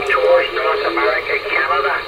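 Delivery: usual speaking voice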